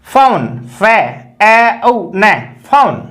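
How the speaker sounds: ए उ ने फोन built up sound by sound, slow. Shown as a demonstration. Teacher building फोन sound by sound for students. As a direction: stretch ए उ ने फोन into its parts. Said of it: "इस प्रकार से".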